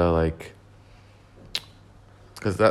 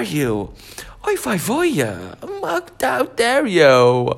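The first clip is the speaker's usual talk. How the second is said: put-on much higher, slow, aghast Yiddish-accented parent; slower than usual. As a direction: gasp and wail it in a Yiddish accent.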